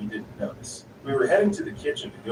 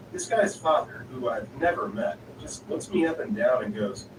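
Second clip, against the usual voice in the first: ordinary delivery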